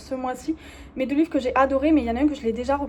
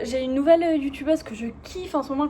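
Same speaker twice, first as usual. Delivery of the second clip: normal talking voice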